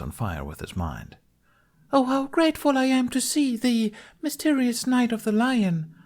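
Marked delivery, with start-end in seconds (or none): 1.9-5.9 very high falsetto damsel in distress; theatrical lament. A narrator's reading. Bunny as accused Lunette greets the knight, lamenting high. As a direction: lament in a hammed-up, piping stage voice. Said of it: "Bunny lamented".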